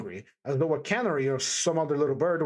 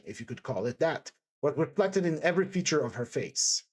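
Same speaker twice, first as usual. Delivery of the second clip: usual speaking voice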